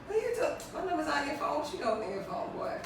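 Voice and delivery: sassy mock reproach; teasing mock accusation. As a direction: tease him for being on his phone. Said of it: "be like".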